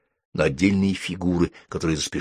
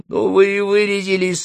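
high little cat voice; pitiful cat; 13 semitones higher, 3 dB louder than usual. A narrator's reading. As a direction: talk like a small, sorry cat. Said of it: "сообщила она". (высокий голос жалкой кошки)

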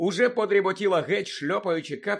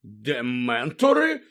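slow, low, incredulous question; stunned Мандангус; 4 semitones lower, 5 dB louder, much slower than usual. A narrator's reading. Lookout echoing the old woman's news, stunned. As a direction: drag the word out in disbelief. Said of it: "перепитав приголомшений Мандангус".

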